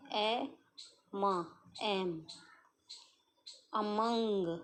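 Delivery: ए and मा stretched very slowly, then among; teacher blending a word slowly; slow sounding out. Shown as a demonstration. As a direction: drag each sound out, then join them. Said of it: "लेकिन पढ़ते वक्त".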